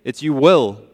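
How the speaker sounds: "will" hit loud, then falling firmly; stress on will. Shown as a demonstration. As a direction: land hard on "will", as a correction of "may". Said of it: "It's not you may".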